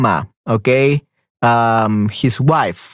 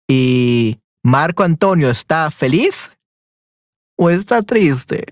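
slowed-down teacher question, every word spaced out; slower than usual. Shown as a demonstration. Teacher asking Esther about Marco Antonio, slowly and clearly. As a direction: stretch it out so the learner catches each word. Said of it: "repeats question for student".

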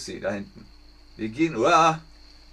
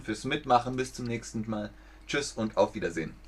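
ordinary talk

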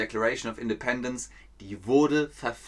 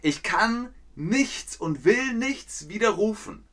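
defiant Luther; 8 semitones higher than usual. Retold or imitated. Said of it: "Er sagt weiterhin".

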